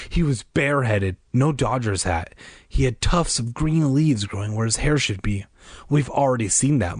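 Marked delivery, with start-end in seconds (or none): none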